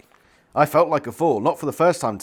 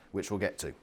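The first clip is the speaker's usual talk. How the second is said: ordinary delivery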